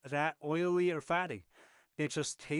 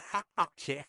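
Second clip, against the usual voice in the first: a quick laugh after sneaking a bite; short laugh. Real, not acted. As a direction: laugh it off, caught in the act.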